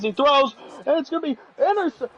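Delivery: rising excitement. Real, not acted. Commentator calling the pass as it's picked, excited. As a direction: build toward the interception.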